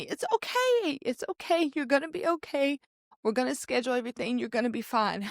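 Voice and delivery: high, gentle comforting voice; soothing nurse. Retold or imitated. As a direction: hush her like a friend holding her. Nurse hugging her, comforting.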